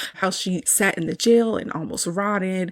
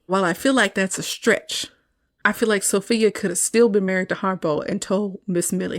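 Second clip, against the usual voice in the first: normal talking voice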